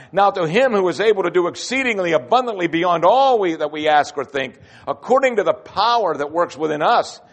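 prayer read aloud with rising fervour; scripture reading. Acted. Preacher reading Paul's prayer aloud to the congregation, rising fervour. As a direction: build the prayer to its doxology.

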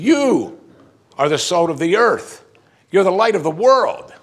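high, loud proclamation with YOU punched; emphatic declaration. Retold or imitated. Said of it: "He means it this way".

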